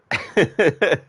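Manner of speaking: high laugh burst. Real, not acted. Streamer laughing at own imitation.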